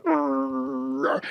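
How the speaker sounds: high, drawn-out mock growl of frustration; wordless angry grrr, acted out. Tapper acting out early anger with no words yet, mock angry growl. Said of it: "when I'm angry".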